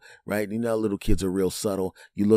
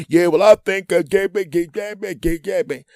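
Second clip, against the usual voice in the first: high, loud blustering gibberish; mock tough guy; 10 semitones higher, 8 dB louder than usual. Husband on the phone to wife, loud mock bluster. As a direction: bluster at full volume like a fake tough guy. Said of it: "He tries to be Captain Tough Guy".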